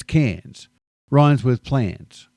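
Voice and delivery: Cairns in one syllable, no R, rhymes with plans; slow Cairns, Kans, silent R; Aussie Cairns. Shown as a demonstration. Teaching Americans the city name, slow Aussie Cairns. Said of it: "One syllable".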